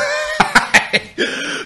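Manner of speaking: airy squeal of laughter; breathy high laugh. Real, not acted. Mate laughing at the [name] clip, high airy laugh. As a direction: break into a wheezing laugh.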